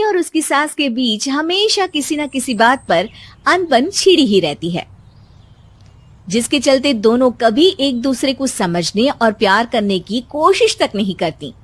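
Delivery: usual speaking voice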